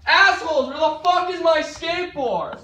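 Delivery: high, loud angry shout; angry yell. Acted. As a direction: yell it across the house at your friends. Yells for missing skateboard.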